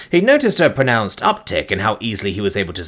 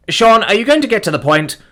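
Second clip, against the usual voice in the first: loud, high, fed up; impatient demand; 9 semitones higher, 5 dB louder than usual. Acted. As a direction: cut him off sharply. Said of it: "Simon demanded impatiently".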